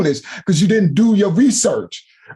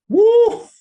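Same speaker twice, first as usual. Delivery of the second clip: triumphant whoop and laugh. Real, not acted. To viewers, gloating whoop and laugh over the contradiction. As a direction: crow with delight at catching him out.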